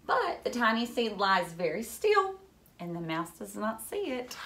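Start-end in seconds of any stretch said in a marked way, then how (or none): none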